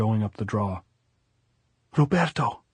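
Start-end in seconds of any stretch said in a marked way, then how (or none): 2.0-2.6 high-pitched call after someone; shouted plea. A narrator's reading. Maria calls back to her lover as she is led away, high shouted plea. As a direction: cry the name out, pitched high. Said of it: "Maria turned and shouted".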